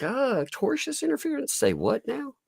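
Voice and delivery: high, baffled voice asking say what now; mock bewildered. Vlogger mocking a lawyer's filing, squeaky and baffled. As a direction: play dumb with a squeaky confused voice.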